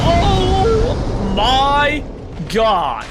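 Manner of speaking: very high, loud drawn-out wail; yelling. Real, not acted. Gamer caught out mid-game, loud high yell. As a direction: scream it out in shock at the game.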